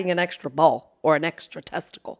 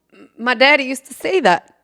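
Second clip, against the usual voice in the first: normal talking voice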